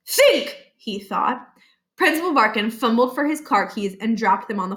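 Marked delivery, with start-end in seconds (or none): 0.1-0.6 high, tense inner command; urgent inner voice. Acted. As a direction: urge himself on. Barkin urging himself.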